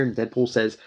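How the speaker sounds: ordinary talk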